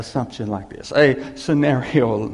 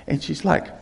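usual speaking voice

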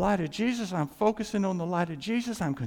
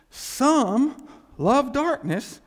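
very slow, louder and higher; drawn out emphasis; 7 semitones higher, 7 dB louder, much slower than usual. Shown as a demonstration. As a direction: stretch each word for weight. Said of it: "emphatic slowed phrase".

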